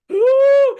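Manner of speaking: very high, loud, drawn-out ooh; hammed-up thrilled mom; delighted squeal. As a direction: squeal with joy at an offered hug. Mom thrilled at offered hug.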